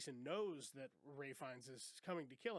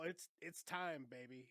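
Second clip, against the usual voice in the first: slow, drawn-out time; lazy teasing announcement; mock drawn-out hype; slower than usual. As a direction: savour the words. Imitating hyped cast.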